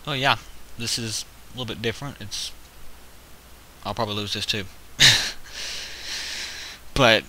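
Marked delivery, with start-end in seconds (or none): 4.7-6.9 short airless chuckle; breathy laugh. Real, not acted. Expecting to lose this game, a breathy laugh. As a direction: laugh it off under your breath. Laughing at own likely loss.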